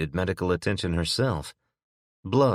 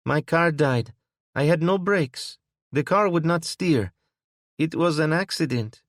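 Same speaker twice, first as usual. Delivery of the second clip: put-on much higher, lightly suggested thick foreign accent; a hint of a thick accent, pleading; the driver explaining her crash to Will, hint of a thick accent; 11 semitones higher, 4 dB louder than usual. A narrator's reading. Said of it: "she said in a thick accent".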